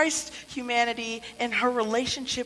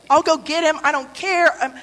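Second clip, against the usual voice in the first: louder, pleading cry; desperate plea; 5 semitones higher, 8 dB louder than usual. Acted. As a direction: beg him, voice rising. Mary voiced pleading, desperate.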